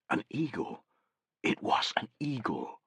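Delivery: soft, breathless wonder; almost whispered in disbelief; awed hush. Acted. Soldier spotting an eagle in the sky, hushed awe.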